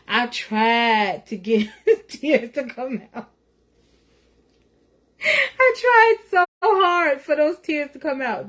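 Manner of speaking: forced crying. Retold or imitated. Describes forcing herself to cry.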